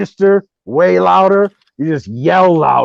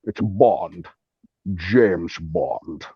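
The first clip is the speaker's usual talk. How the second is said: low Scottish accent with slushy s; Sean Connery; 8 semitones lower, 5 dB quieter than usual. Shown as a demonstration. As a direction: deliver it as a deep Scottish movie spy. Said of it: "That's how you do a Sean Connery accent".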